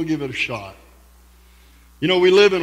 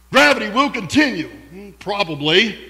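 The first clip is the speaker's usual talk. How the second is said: infomercial announcer; 3 semitones higher, 4 dB louder than usual. Pretend. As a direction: sell it like a late-night ad. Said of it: "It sounds like an infomercial".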